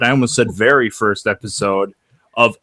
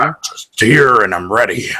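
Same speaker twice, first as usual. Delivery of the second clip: masculine tone; 4 dB louder than usual. Shown as a demonstration. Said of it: "more of a masculine tone".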